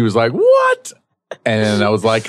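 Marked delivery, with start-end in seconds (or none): 0.3-0.9 surprised. Retold or imitated. Friend confused by question.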